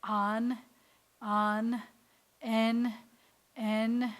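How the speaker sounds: each syllable held and separate; measured vowel drill with a closing N; sounding out syllables. Shown as a demonstration. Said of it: "sound it out like this".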